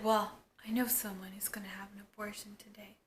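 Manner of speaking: breathy secretive whisper, no voice at all. Retold or imitated. Heather whispering to Spike about Erica's abortion.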